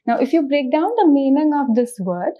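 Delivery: ordinary talk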